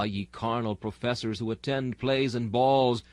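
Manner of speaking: ordinary talk, nothing marked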